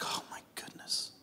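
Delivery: breathless whisper of relief; barely voiced, overcome with relief; awed whisper. Real, not acted. Father whispering as his newborn finally cries. Overwhelmed relief at baby's cry.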